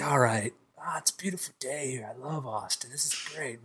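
very soft, low musing to oneself; near-whisper, relaxed and private; quiet contented murmur. Acted. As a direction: murmur it under your breath, contentedly. Voicing a relaxed moment on river.

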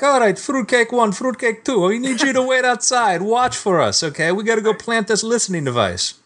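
a hint of a smug Italian officer; Italian cop's voice. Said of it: "They're like".

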